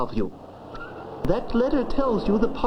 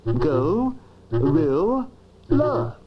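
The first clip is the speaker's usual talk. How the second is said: Go, rill, la with a clear pause between each syllable; split into syllables; 5 semitones lower, much slower than usual. Shown as a demonstration. Dictionary showing a child the syllables, spoken beat by beat. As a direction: break "Go, rill, la" into three separate beats. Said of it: "always broken down into their syllables".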